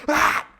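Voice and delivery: loud short yell; sudden explosive "ah"; attacking cry. Shown as a demonstration. Long John going for Tom, loud mock cry. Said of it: "He goes".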